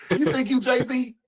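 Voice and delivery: high, slow, drawn-out scolding; exasperated fatherly teasing; mock-incredulous dad. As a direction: cut a cocky kid down to size, amused. Father mocks son's attitude.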